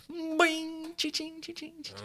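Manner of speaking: high cha-ching sound effect; cash register sound. Pretend. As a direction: ring it out like a register. Imitating money sounds.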